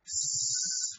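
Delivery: a long voiceless hiss of S. Shown as a demonstration. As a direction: hold a pure sss. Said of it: "the S sound".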